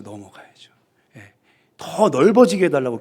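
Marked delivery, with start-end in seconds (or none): 0.0-1.3 넘어가야죠를 숨소리로 속삭이듯; 혼잣말처럼 넘어가야죠를 속삭여; 속삭임. Shown as a demonstration. (넘어가야죠를 작게 속삭이기)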